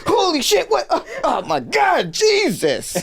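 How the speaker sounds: hammed-up alarmed woman; panicked shrieking. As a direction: shriek in horror at a cough behind you. Lady panics at cough behind her.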